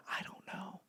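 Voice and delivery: dazed whisper. Retold or imitated. Binge-watcher caught zoning out at the wheel, whispering. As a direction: whisper it, lost in the show. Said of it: "And you're like".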